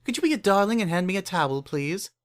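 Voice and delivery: put-on a refined older sister asking for a towel, sweetly; grown mare voice. Refined mare asking little sister for a towel, sweetly.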